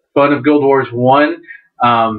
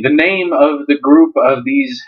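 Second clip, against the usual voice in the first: ordinary talk, nothing marked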